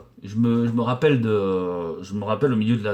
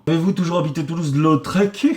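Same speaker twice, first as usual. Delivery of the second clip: amused reading; 6 semitones higher, 4 dB louder than usual. Real, not acted. Reading a silly question, laughing.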